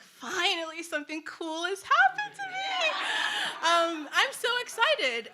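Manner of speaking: high, gleeful inner voice; thrilled squeal in her head. Retold or imitated. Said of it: "And internally, I'm like".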